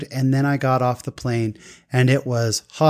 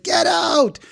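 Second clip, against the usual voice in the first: high-pitched excited disbelief; Abdulia. Retold or imitated. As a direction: squeal it in delighted amazement. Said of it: "He got really excited".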